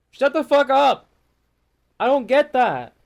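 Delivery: loud angry shout at corporate clichés; angry outburst. Real, not acted. Ranting vlogger yells at team-building talk, loud and angry. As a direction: yell it out, fed up.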